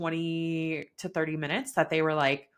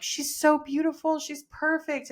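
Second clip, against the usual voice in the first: gushing husband; 10 semitones higher than usual. Retold or imitated. Husband gushing over newborn.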